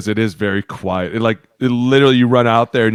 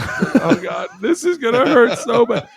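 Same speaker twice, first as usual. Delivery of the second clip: much higher, laughing through it; laughing dread; 12 semitones higher, slower than usual. Retold or imitated. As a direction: groan it out while cracking up. Player bracing for film session.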